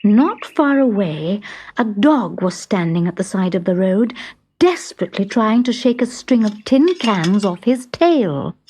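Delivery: ordinary delivery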